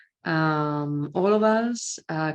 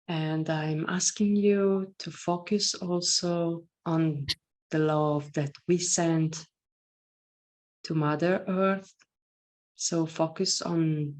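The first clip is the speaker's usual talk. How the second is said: guided meditation. Acted. Leads a meditation.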